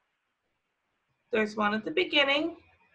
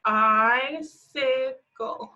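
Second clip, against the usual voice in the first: very slow sounding out; 2 semitones higher, much slower than usual. Shown as a demonstration. Teacher drawing out "Icicle" so kids hear both sounds. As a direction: drag "Icicle" out very slowly, rising at the end. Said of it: "two I sounds in that one".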